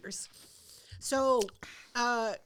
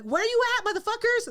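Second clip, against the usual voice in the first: calling out; 8 semitones higher, 3 dB louder than usual. Retold or imitated. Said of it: "And I'm like".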